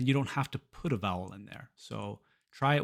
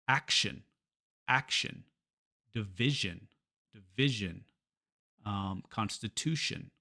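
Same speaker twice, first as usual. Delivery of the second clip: action, division, constitution stressed on the syllable before -ion; English action, division, constitution with weak, reduced endings; 3 semitones lower than usual. Shown as a demonstration. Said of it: "it's always the syllable before the ion".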